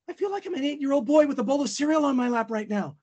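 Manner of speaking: put-on high, rushed childlike wonder; awestruck little kid. Fan recalling hearing [name] live, high and rushed childlike awe. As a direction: gush it in a high, thin voice. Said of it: "I'm like".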